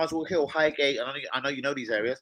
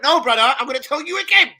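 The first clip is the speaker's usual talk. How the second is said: firm insistence; 8 semitones higher, 9 dB louder than usual. Retold or imitated. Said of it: "I went".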